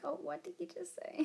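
quick, quiet quizzical question; tossed off fast as a puzzled friend; mock confused listener. A friend puzzled by her wrong word, quick and quiet. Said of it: "then it's like".

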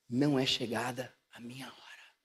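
low, whispered confidence; sussurro confidencial. Acted. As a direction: whisper it privately to your mother. Jesus confides in his mother. (sussurrando em segredo)

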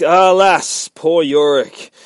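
loud, high, drawn-out declamation; mock-theatrical lament. Victoria taunting Yorick with Hamlet, mock-theatrical lament. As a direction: declaim it grandly, stretching the last words.

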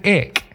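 "ick" with a hard k, not ich. Shown as a demonstration. Friend copies the Berlin way: "ick" with a hard k. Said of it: "So he's saying".

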